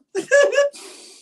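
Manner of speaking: a high, loud laugh that cracks into a sob; tearful laugh. Real, not acted. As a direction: laugh out the tears. Crying on camera, laughing it off.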